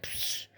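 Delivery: soft imitated shatter, "psh"; quick hushed glass-breaking sound effect; shattering glass noise. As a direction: mimic a window shattering, softly. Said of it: "like, this shatter".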